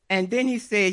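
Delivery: usual speaking voice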